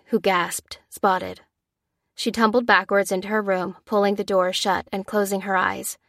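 usual speaking voice

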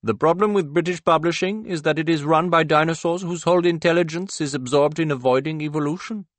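lofty, lecturing author on television; a celebrated author pronouncing on TV; Banerjee on TV. A narrator's reading. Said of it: "the pre-recorded Banerjee pronounces".

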